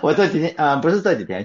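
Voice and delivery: ordinary talk, nothing marked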